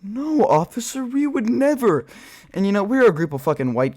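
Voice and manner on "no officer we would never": high, slow fake-innocent reply; mock innocent. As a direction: play the angel for the cop, sweetly and slowly. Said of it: "And you're like".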